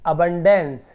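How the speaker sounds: "अबंडेंस" with short a sounds, loud; pronunciation demo. Shown as a demonstration. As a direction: teach "अबंडेंस" firmly, opening on a short "अ". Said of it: "इसका उच्चारण अ होगा".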